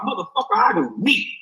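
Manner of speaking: ordinary talk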